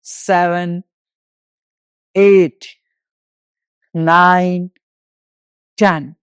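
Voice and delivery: drawn-out single counts with pauses between; exercise count-off. Real, not acted. Yoga teacher counting walking steps for the class, instructor's count-off.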